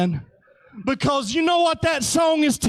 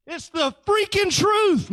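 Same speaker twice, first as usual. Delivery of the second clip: emphatic declaration; 8 semitones higher than usual. Real, not acted. Preacher insisting.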